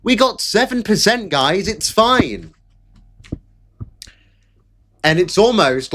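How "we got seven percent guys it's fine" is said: high, whiny mock reassurance; mocking Lotus Eaters. Streamer mocking rival channel's excuses, high whiny voice. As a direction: parody them insisting it's fine. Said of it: "they're like".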